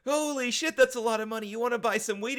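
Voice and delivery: high, loud stoner excitement; a hammed-up giddy dealer pitch; Jay impression. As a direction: bounce it up high like a hyped stoner. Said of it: "He's like".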